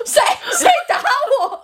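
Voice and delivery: high, loud laughing mimic of a dazed man; dazed boyfriend. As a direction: mimic him waking up confused, laughing. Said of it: "我男朋友起来之后就这样". (笑着高声模仿刚被砸醒的男友)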